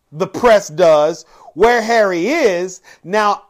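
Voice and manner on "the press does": ordinary talk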